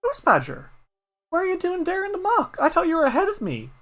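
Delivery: bewildered youngster asking; high quick puzzled child; young Patter. Acted. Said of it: "and says".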